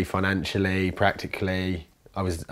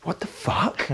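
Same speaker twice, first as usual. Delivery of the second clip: stunned whisper; 9 semitones higher, slower than usual. Real, not acted. Stunned aside after the outburst.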